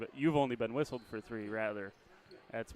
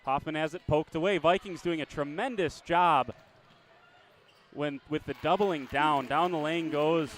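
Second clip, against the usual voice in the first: brisk live game call, rising with the action; play-by-play; 3 semitones higher, 4 dB louder than usual. Acted.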